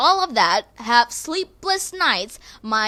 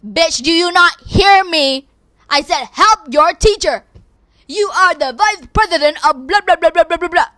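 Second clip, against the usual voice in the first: angry teacher; 5 semitones higher, 8 dB louder than usual. Retold or imitated. Teacher yelling at the student again, angry. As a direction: bark each word like a fed-up teacher. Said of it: "She said".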